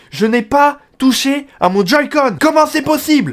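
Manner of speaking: voix qui monte très haut, mots étirés; protester, scandalisé de n'avoir rien touché; incrédulité indignée. Real, not acted. Indigné par un jeu imperdable. (indigné, incrédule)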